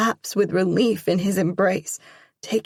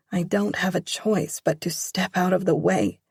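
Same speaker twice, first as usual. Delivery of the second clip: ordinary delivery, nothing marked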